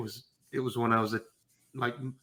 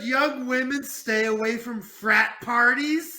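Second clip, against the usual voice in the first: mock outrage; 10 semitones higher, 6 dB louder than usual. Mock outrage at victim blaming.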